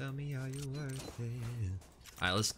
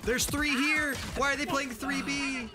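excited shout; 5 dB louder than usual. Real, not acted. Surprised shout mid-round.

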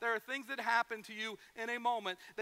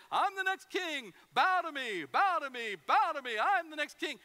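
mock boastful king; 5 semitones higher, 6 dB louder than usual. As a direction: ham up a pompous would-be king commanding bows. Mock boastful king demanding bows.

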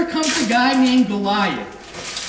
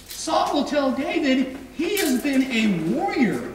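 ordinary talk

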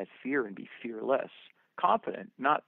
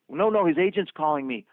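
higher, louder insistence; agitated protest; 6 semitones higher, 6 dB louder than usual. Retold or imitated. Director contradicting the production office, agitated and loud. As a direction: cut in loudly, retelling a heated argument.

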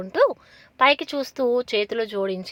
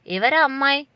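a stern, pointed question, somewhat higher and louder; 5 semitones higher, 5 dB louder than usual. A narrator's reading. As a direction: demand to know who the girl is. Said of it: "అడిగింది సీరియస్గా".

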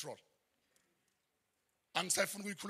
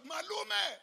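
retold shout; 11 semitones higher than usual. Niece asking her uncle for money in class, high mock shout. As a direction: call out high, imitating a pleading niece. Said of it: "She would shout".

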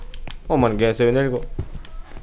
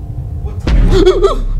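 loud, high gasp of fright; real jump-scare gasp; startled gasp; 8 dB louder than usual. Real, not acted. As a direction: gasp sharply at a sudden scare. Startled by the game.

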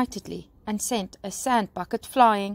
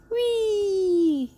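high, long squeal sliding downward; gleeful whee; 11 semitones higher than usual. A narrator's reading. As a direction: squeal like a child on a slide. Children sliding down the pole.

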